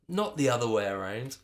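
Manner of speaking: plain reading voice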